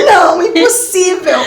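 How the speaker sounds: negue alto e agudo, arrastando, quase rindo; negação incrédula. Retold or imitated. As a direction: protesto exagerado, devagar e com riso. Nega o resultado do teste. (negação incrédula, em voz alta)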